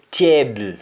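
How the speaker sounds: "table" with the ending as a short bo sound; Jamaican pronunciation. Shown as a demonstration. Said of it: "Jamaican, we say".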